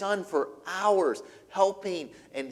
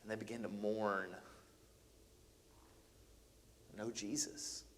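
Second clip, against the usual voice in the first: hushed lament; 7 semitones lower, 18 dB quieter than usual. A narrator's reading. Hushed dramatic preaching.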